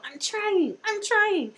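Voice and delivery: put-on high, squeaky little-kid voice; toddler voice. Nephew pushing Duplo blocks together, high squeaky toddler. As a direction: chirp it like a proud two-year-old. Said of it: "we'll go".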